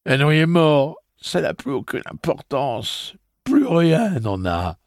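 high, loud despair, slowing at the end; despairing grief. A narrator's reading. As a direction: let it fall into hopelessness. Eddie grieving his brother.

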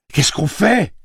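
high, loud cry for help; cri affolé. A narrator's reading. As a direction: shout it as an urgent, panicked question. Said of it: "cria-t-elle en se penchant". (cri aigu et affolé)

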